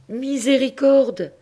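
high, loud, slow anguished cry; pope's cry. Acted. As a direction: cry it to heaven. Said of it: "fit le pauvre pape". (le pape s'écrie, affolé, très aigu et fort)

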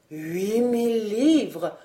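very slow, drawn-out reckoning; calculating undertone. A narrator's reading. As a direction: muse over the sum, stretching it out. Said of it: "supputa le vieil Alain à voix basse". (supputer lentement, comme à part soi)

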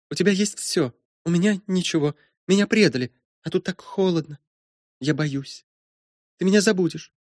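put-on very high, small girl's voice; trembling plea. A narrator's reading. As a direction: plead in short, wounded phrases. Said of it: "губы Гизелы дрожали".

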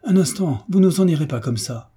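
exclaiming. A narrator's reading. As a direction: call out to stop someone leaving. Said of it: "retint Gérald par le bras et s'écria". (exclamation vive, voix plus aiguë)

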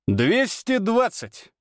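slow, high, proud proclamation; triumphant repeat. Acted. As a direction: announce the number like a trophy.

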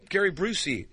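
ordinary talk

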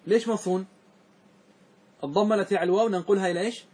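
ordinary talk

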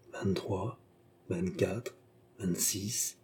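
hushed, soft count to oneself; breathless-with-joy counting. A narrator's reading. Beausire gathers the gold coins, counting in hushed joy. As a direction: count softly, absorbed in the treasure. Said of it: "pantelant de joie".

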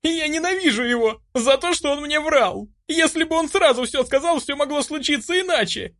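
very high, hateful tearful tirade; anguished high-pitched outburst; sobbing at full voice. A narrator's reading. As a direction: wail out your hatred hysterically. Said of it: "Рыдала я во весь голос".

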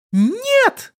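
very high thin squeal; child's shriek. A narrator's reading. Little girl deafening him with refusal, shrieking. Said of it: "Звуковой удар, который исторгла из себя малявка".

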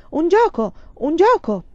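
high, loud excited shout; a small boy crying out in delight; yelling about a game, high and loud; child's excited yell. Acted. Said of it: "urlò".

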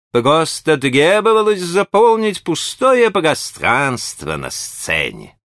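mumbling burred actor voice; modest burring actor. A narrator's reading. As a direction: shrug it off modestly. Keister modest.

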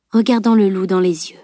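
plain reading voice

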